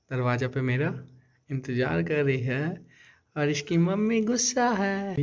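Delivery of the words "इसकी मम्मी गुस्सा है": drawn-out, higher-pitched teasing; slow, lifted tease; teasing announcement. Real, not acted. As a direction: stretch it out slowly and high, teasingly. Teasing announcement that mom is upset.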